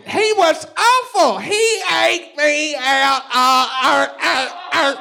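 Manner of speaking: very high, slow put-on woman's voice; mock woman voice. Imitating the woman from the argument, high and slow. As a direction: squeak it out, dragging each word.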